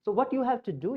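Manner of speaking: ordinary delivery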